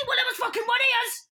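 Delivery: a minion shouting blame at Maria, high and loud; high angry blame. Pretend. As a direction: yell the blame onto someone else.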